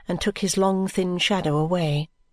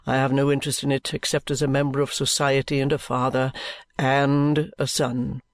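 low, measured, solemn lawyer voice; lawyer Vholes; 4 semitones lower than usual. A narrator's reading. Lawyer Vholes assures the guardian, low and solemn.

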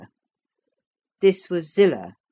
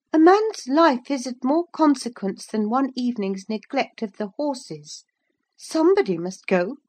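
put-on high-pitched young woman's plea; kindly Missus Heathcliff; 9 semitones higher, 3 dB louder than usual. A narrator's reading. Wife pleading for the stranded visitor, kindly. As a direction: make a kind case in a higher voice. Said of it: "murmured Missus Heathcliff, more kindly".

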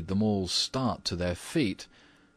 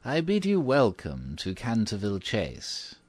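put-on old housekeeper's quaint, formal welcome; quaint, old-fashioned; 3 semitones higher than usual. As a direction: welcome them in a quaint old-world manner. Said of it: "in a quaint, old-fashioned manner".

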